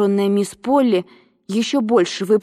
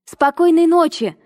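high, happy calling-out; cheerful call; 8 semitones higher than usual. A narrator's reading. Girl running out of the parlour, calling cheerfully. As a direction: call it gaily on the way out. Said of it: "весело крикнула она".

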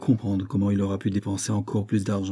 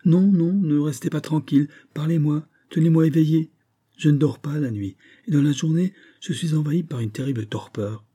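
high, urgent plea; distressed, insistent begging; agitated pleading; 6 semitones higher, 4 dB louder than usual. A narrator's reading. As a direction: beg a friend anxiously, voice raised. Desperately begging to be kept awake.

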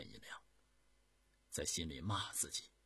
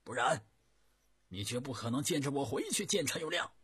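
loud, much higher ringing challenge across the water; loud proud call; 8 semitones higher, 7 dB louder than usual. A narrator's reading. Decoy hailing the enemy general, loud and proud. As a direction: call out boldly, head high. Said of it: "然后大声喊道".

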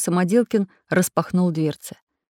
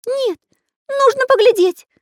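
very high, insistent squeak; insistent Карандаш; 6 dB louder than usual. A narrator's reading. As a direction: squeak it stubbornly. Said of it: "твердил Карандаш".

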